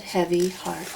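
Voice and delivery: ordinary delivery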